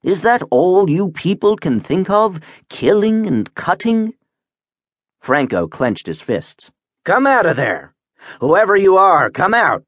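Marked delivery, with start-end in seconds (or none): 0.0-4.1 high-pitched protest from a dismayed creature; high, appalled outcry; crying out in protest. A narrator's reading. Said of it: "the wub cried". 7.0-9.8 high, forceful captain's challenge; raised-pitch demand from an angry captain; angry command. Acted. Captain challenges hidden speaker, fists clenched.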